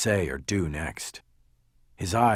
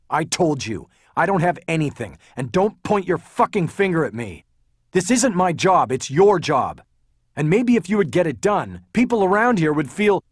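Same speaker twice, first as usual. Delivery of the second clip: loud furious retort; angry shouting; 9 semitones higher, 7 dB louder than usual. A narrator's reading. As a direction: shout back at the cop. Haller shouts back.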